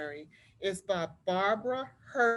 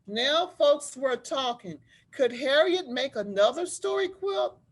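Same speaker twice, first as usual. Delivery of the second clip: put-on lively read-aloud voice for children; story narration; 3 semitones higher, 5 dB louder than usual. A narrator's reading. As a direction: tell it like a storybook to a class. Teacher reads picture book aloud.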